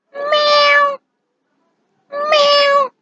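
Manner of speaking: high, drawn-out cat meows. Shown as a demonstration. Teacher giving the Indonesian cat sound, meowing high. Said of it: "Kucing bersuara".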